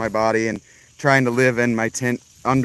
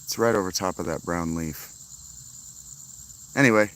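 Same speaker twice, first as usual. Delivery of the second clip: ordinary talk, nothing marked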